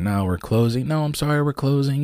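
high, singsong turn-away; mock brush-off. Restaurant staff turning away late diners, singsong brush-off. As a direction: imitate a rote, high-pitched apology.

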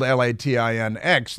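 normal talking voice

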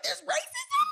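mock shock. Imitating friend's shock.

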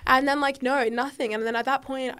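usual speaking voice

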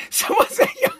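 squeaky high laugh through the words; cracking up mid-line; giggling it out, pitch way up. Real, not acted.

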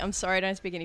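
ordinary talk

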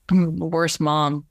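usual speaking voice

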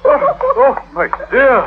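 sudden high alarmed cry; startled gasp of worry. Acted. Suitor startled when the girl trips, alarmed. She falls tangled in rope.

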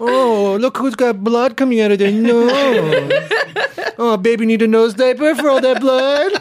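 mock baby talk. Teasing a cohost about his stroke, high mock baby talk. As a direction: coo at him like a hurt baby.

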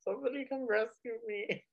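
high, soft frightened little voice; scared little pumpkin. Retold or imitated. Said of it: "He's like".

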